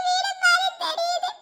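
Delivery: ordinary talk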